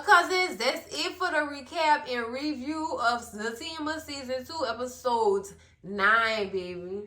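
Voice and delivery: ordinary talk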